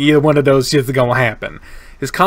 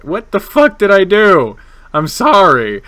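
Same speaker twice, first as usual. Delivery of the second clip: loud, raised-pitch outburst; flustered protest; 7 semitones higher, 9 dB louder than usual. Real, not acted. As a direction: cry out in flustered apology.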